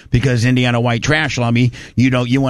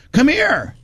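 calling out; 12 semitones higher than usual. Retold or imitated. Mom calls Doug over.

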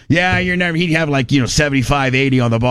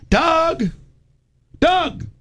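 mom calling Doug, pitched high. Retold or imitated. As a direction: call out to him across the yard.

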